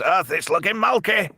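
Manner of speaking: high put-on Scottish accent. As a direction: imitate a Scottish sci-fi spoof line. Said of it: "that Scottish Star Trek".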